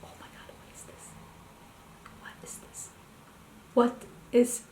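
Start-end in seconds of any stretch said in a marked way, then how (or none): 0.0-2.9 stunned, hushed whisper; awed whisper. Real, not acted. Staring at a mystery palette in an awed whisper. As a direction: breathe it out in disbelief.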